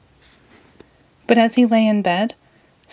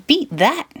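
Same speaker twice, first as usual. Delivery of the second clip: happy yell; 8 semitones higher than usual. Acted. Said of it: "yelled SpongeBob happily".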